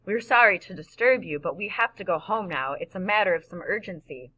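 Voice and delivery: plain reading voice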